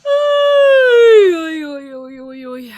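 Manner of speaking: high shout fading down; loud calling out. Real, not acted. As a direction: call out loud then settle. Calling out loudly.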